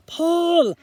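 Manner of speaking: big loud urging call; heave-ho command; shouted pull. Acted. Bear urges Mouse to pull the map open, loud.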